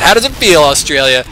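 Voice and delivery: high, loud teasing call; playful gloating yell; mock taunting shout. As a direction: crow it at a whole country in jest. Mock taunt called to Australia.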